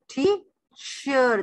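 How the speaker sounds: Teacher drawn out as टी... चर; very slow teacher, pause after the first syllable; split into two syllables. Shown as a demonstration. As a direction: stretch teacher into two separate beats. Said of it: "दो syllable हो गए".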